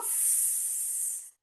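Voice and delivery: long voiceless sss; snake hiss. Pretend. Teacher being the snake that is back, long soft hiss.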